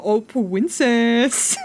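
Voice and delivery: slow, drawn-out cooing princess; mocking chat's cooing; mock sweet-talk. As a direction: imitate a smarmy sweet-talker. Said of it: "come into my fucking home and go".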